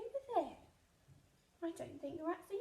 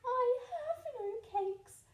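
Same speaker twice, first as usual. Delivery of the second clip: timid rabbit; 8 semitones higher, 7 dB louder, slower than usual. Acted.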